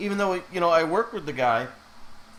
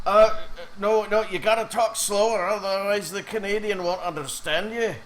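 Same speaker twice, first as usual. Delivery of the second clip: imitated Scottish brogue, raised in pitch and volume; drinking Scotsman's brogue; 4 semitones higher than usual. Scottish friend telling his mates about the Canadian, imitated brogue. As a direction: put on a tipsy Scot's thick brogue. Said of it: "his brogue became more, um, more pronounced".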